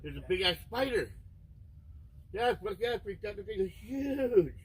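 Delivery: a sudden yelp of alarm at the huge spider; startled by a spider. Real, not acted. Streamer spotting a huge spider, startled high yelp.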